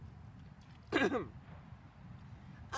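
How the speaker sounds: a polite throat clear. A narrator's reading. Pig clears his throat to get attention. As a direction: clear the throat before speaking. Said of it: "So Wilbur cleared his throat".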